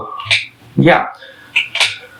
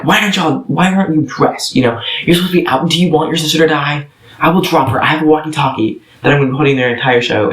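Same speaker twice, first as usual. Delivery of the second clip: higher, menacing villain voice, rushed in places; villain's threat; 5 semitones higher, faster than usual. Acted. Olaf threatening the kids backstage, menacing villain voice. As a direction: threaten them like a stage villain.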